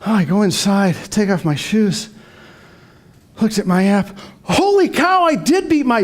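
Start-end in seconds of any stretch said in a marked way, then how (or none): 4.4-6.0 much higher, startled exclamation; the runner shocked to see his record on the app; surprised. Real, not acted. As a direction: burst out in sudden surprise. Discovers he beat his best.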